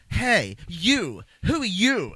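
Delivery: loud, high, swooping challenge; hammed-up hostile demand; mock angry. Retelling the prisoners' first exchange, exaggerated angry shout. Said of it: "why are you so angry at each other?".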